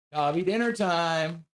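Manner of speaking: ordinary delivery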